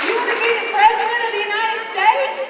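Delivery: loud, high, emphatic cheerleading; rousing shout. Real, not acted. Coach urging students to dream big, loud rousing shout. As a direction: pump the crowd up, loud.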